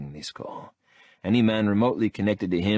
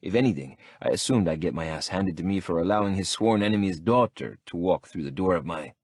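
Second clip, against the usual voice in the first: ordinary delivery, nothing marked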